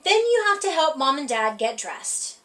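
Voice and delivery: usual speaking voice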